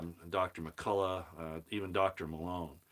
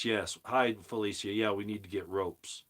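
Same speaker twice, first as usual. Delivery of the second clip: ordinary talk, nothing marked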